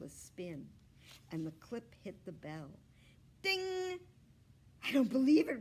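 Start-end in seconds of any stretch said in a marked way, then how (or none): 3.4-4.0 high bright ding; bell ding. A narrator's reading. The bell ringing at last. 4.8-5.6 much louder, higher astonished cry; amazed. A narrator's reading. Boy astonished his project bell rings.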